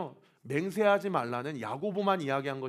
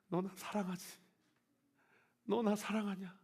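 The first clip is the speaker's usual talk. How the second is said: tender question; 4 semitones higher, 6 dB quieter than usual. Retold or imitated. Jesus asks Peter softly.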